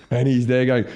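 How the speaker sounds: ordinary talk, nothing marked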